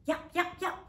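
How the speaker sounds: high, quick yapping; small dog yap. A narrator's reading. Little dog Harry Maclary yaps back at the cat, high. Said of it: "said Harry Maclary".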